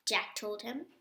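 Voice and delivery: ordinary delivery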